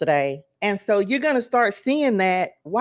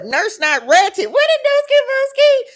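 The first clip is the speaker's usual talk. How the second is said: high, loud playful holler; shouted catchphrase; 8 dB louder than usual. Pretend. Streamer hollering a catchphrase at viewers, high playful shout. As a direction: belt out a silly catchphrase, very high.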